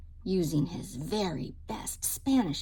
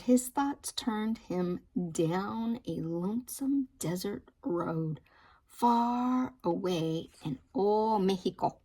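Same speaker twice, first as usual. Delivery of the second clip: plain reading voice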